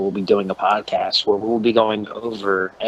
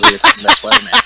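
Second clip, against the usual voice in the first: fast, loud cartoon announcer; Speed Racer cartoon voice; 12 semitones higher, 10 dB louder, much faster than usual. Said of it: "talking like a Speed Racer cartoon".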